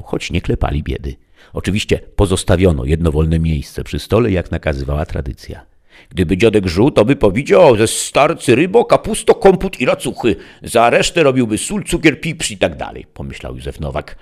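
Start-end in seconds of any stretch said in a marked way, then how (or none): 6.1-13.1 put-on high, loud old-country grumble; grandpa's rustic voice. A narrator's reading. Father thinking what late grandpa would say, high rustic voice. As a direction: voice an old villager, pitched high and forceful. Józef imagines grandpa's words in dialect.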